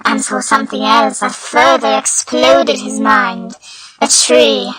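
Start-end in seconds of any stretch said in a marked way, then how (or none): none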